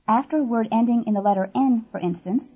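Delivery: ordinary talk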